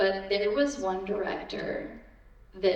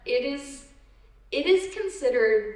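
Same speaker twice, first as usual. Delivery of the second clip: ordinary talk, nothing marked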